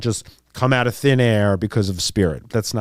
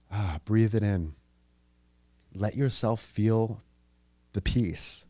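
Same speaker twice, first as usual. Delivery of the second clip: slow, softer voice easing you into calm; soothing guidance; 6 dB quieter, slower than usual. Shown as a demonstration. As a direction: breathe it in with them, gently. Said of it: "guides listener to breathe".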